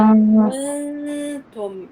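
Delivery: long nasal ghunna in "كنتم" before the ta; tajweed demonstration. Shown as a demonstration. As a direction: hold the nasal n of "كنتم" close to the t position. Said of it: "بالقرب من مخرج التاء".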